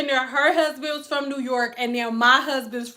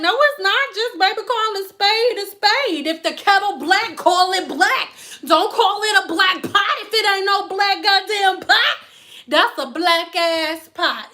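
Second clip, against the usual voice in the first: emphatic lecture; 6 semitones higher than usual. Retold or imitated. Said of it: "She was like".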